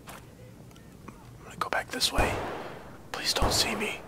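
hushed, urgent whisper while hiding; stealthy whispering under the breath; tense whisper. Real, not acted. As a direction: whisper it so nobody finds you. Hiding from enemy in game.

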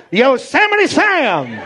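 high, drawn-out cartoon-name holler; slow, high comic outburst; comic exclamation. Shown as a demonstration. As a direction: stretch the name out high, falling at the end. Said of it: "he's like".

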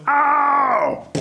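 high, drawn-out howl of pain; pained yelp. Acted. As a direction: shout a long mock ow as a smacked alligator. Alligator is hit on bottom.

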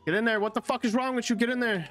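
frustrated yell. Real, not acted. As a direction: yell at the stuck character. Frustrated yelling at game character.

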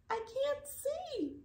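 high, slow squeaky complaint; high snail voice. A narrator's reading. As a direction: squeak it slowly, like a small snail. Snail voice in story.

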